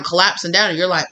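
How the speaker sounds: ordinary delivery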